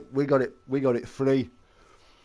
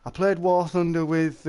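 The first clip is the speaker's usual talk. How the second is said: ordinary talk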